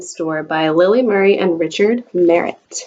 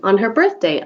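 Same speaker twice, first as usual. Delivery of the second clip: usual speaking voice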